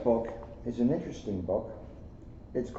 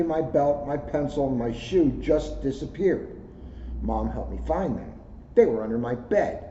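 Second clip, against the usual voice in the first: gentle storybook read-aloud; reading to children; 3 semitones higher than usual. Acted. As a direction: read it like story time for kids. Resumes reading the kids' book.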